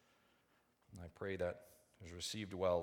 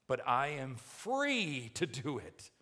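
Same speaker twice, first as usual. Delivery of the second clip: loud, insistent objection, FREE stretched high; defiant protest; 5 semitones higher, 6 dB louder, slower than usual. Acted. Preacher voices believer clinging to liberty, defiant protest. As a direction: protest it slowly and forcefully.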